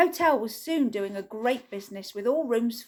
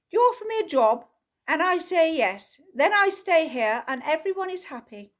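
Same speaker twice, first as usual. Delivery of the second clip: brisk matter-of-fact; 6 semitones higher, 4 dB louder than usual. Acted. Tibblethwaite states the obvious.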